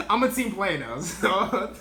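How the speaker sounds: real laughter breaking up the words; amused laughing. Real, not acted. Amused at own slacking.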